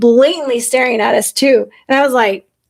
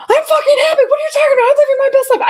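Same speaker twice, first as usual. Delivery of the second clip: high, indignant mock protest; defensive protest; 13 semitones higher, much faster than usual. Snapping back at a stranger about her happiness, high indignant protest. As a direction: insist you're happy, voice shooting up. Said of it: "I wanted to defend it so bad".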